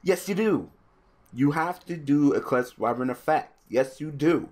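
high, loud back-and-forth insistence; heated argument. Real, not acted. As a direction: shout down the opponent's claim. Arguing rules with opponent.

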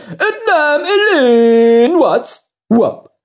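reversed playback of speech; backwards speech. Shown as a demonstration. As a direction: sound like a tape played backwards. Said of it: "Bauzaunelemente haben wir jetzt rückwärts gemacht".

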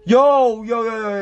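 high, loud panicked yo; real alarm bursting out; frightened shout. Real, not acted. Spooked by the call.

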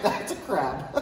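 laughing while saying it. Retold or imitated. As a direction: laugh out the words. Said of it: "I was like".

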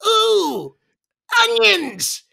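imitating Bill Raftery on air; Raftery catchphrase. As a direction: yelp it like the color man. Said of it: "Bill Raftery ... says".

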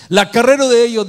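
loud and high, as if crying out; loud emphasis. Acted. Preacher crying out the prophet's words to the church, loud and high. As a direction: throw the phrase out to the congregation.

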